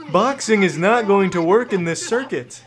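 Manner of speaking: normal talking voice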